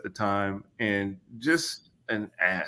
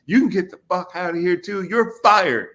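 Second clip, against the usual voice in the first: higher, louder barked dismissal; mock coach yell; 8 semitones higher, 6 dB louder than usual. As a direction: imitate the coach throwing you out. Coach yelling at him.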